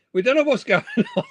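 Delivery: laughing. Real, not acted. Giggling about laughing fits.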